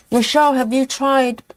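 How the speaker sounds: talking with a mouthful of chocolate; words round a bite of chocolate; mouth full. Real, not acted. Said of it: "sorry, I'm eating my chocolate".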